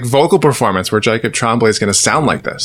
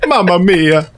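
mock Italian; 3 semitones higher than usual. Italian bit.

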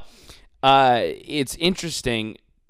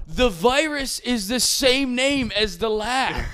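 higher, slow, laughing incredulous shout; 10 semitones higher, slower than usual. Retold or imitated. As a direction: shout it through a laugh, slowly. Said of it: "He's like".

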